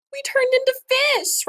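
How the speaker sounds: high loud cry. Acted. Boy just turned into a fish: high cry. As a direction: wail it up high in disbelief. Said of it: "Raphael cried".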